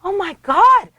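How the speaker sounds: high, loud imitated gasp of delight; retold amazement. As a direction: exclaim like an amazed coworker hearing big news. Acting out colleague's amazed reaction.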